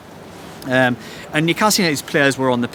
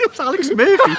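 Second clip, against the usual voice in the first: laughing; 12 semitones higher, 3 dB louder than usual. Real, not acted. Laughing at the memory.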